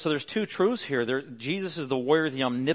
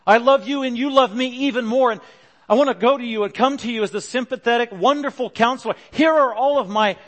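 higher, louder, earnest prayer voice addressed to Jesus; spoken prayer; 8 semitones higher, 7 dB louder than usual. Shown as a demonstration. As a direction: pour out your heart to the Lord. Models a personal prayer to Jesus.

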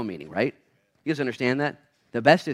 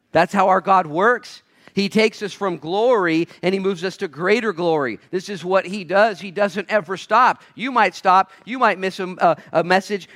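high, loud, driving preacher's delivery; full-force preaching; 7 semitones higher, 6 dB louder than usual. Real, not acted. Preacher telling the church God never stops, full-force preaching. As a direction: drive each phrase home to the congregation.

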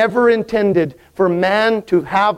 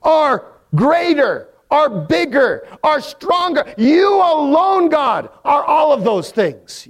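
angels' praise; 6 semitones higher than usual. Acted. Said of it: "what they mean by that is".

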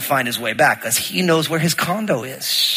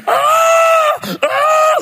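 high piercing startled scream; wife screaming in the garage at a mouse, high shriek; retold scream; 5 dB louder than usual. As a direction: shriek like you just saw a mouse. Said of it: "Lynn starts screaming".

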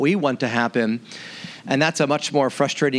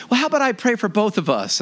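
higher, light fiancee voice; fiancee's offer; 7 semitones higher than usual. Retold or imitated. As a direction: voice her gently and higher. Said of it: "And she goes".

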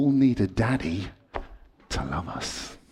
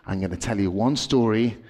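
normal talking voice

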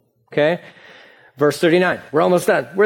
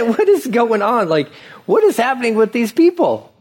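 what on earth, pitched up; incredulous; 6 semitones higher than usual. Real, not acted. Pastor baffled by the Israelites' complaints, incredulous.

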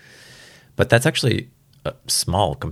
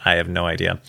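ordinary talk, nothing marked

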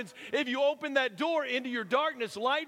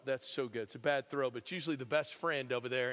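ordinary delivery

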